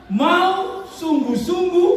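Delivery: high, very slow stress on sungguh-sungguh; drawn-out emphasis. Real, not acted. Preacher drawing out key words.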